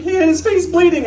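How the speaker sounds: high, loud alarmed cry; imitated panic. Wife tells husband about their son, imitated high panic. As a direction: call it out like an alarmed mother.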